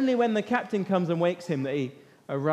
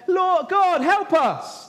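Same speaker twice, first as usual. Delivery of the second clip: much higher and louder, desperate wail to God; terrified crying out; 10 semitones higher, 4 dB louder, slower than usual. Pretend. Sailors in the storm crying to their gods, terrified. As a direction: cry out for help as the boat breaks up. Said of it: "It's terrified crying".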